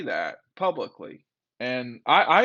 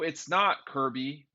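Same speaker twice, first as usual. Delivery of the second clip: ordinary talk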